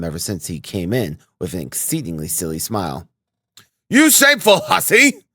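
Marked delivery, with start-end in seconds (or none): 3.9-5.2 shrieking top-of-the-lungs rebuke; furious shout. A narrator's reading. Police officer shouting at the landlady. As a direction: bellow it at her, outraged. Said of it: "he shouted suddenly at the top of his voice".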